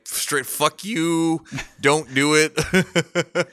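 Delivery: slow, drawn-out warning from the liver. Retold or imitated. Liver warning its owner, slow and drawn out. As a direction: stretch each word out like a pleading liver. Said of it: "my liver was like".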